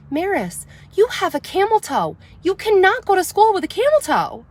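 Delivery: high, emphatic retelling of telling her daughter off; stunned mom stressing the camel toe; incredulous mom. Retold or imitated. Confronting daughter about pants.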